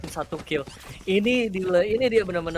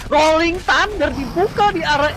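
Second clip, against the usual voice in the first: excited; 5 semitones higher, 6 dB louder than usual. Real, not acted. Fast play-by-play of utility.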